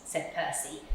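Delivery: usual speaking voice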